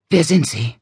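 breathless, frightened question; gasping for air. A narrator's reading. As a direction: gasp the words out. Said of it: "keuchte sie". (atemlos keuchend)

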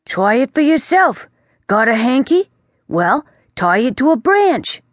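put-on a know-it-all bat lecturing the boy; chatty bat. The bat warns the young prince, breezy and chatty.

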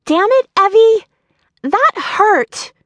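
high, loud yelp of pain and annoyance; pained outburst. A narrator's reading. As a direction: snap at her friend after getting hurt. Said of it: "Brooke cried out in pain".

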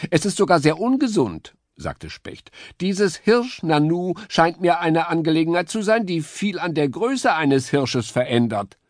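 very high, chirpy bird voice; high woodpecker voice. A narrator's reading. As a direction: play the eager little woodpecker.

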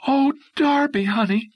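high, loud gushing call; excited shout. A narrator's reading. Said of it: "Dolly Beavers shouted".

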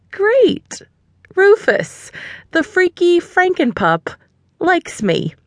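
high, dripping sarcasm; mock enthusiasm about the creepy dog; sarcastic. A narrator's reading. Narrator's sarcastic thought about the dog, high and mocking.

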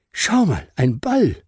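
put-on high, bright child's voice showing off a find; boy calling his mother, high and excited; excited little boy. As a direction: sound like a delighted small boy. Flo shows ball to mother.

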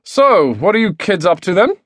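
high, bouncy dad voice; cheery dad. A narrator's reading. Dad cheerily quizzes the kids.

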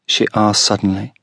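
plain reading voice

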